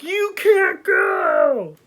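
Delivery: high, slow drawn-out yell; furious shout. Acted. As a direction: scream it as you chase them. Pharaoh chasing, shout.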